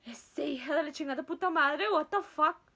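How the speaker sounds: loud, high angry cursing; mother's outrage. Retold or imitated. Mom cursing out the sister over Tylenol, angry outburst. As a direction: imitate a mom exploding in outrage.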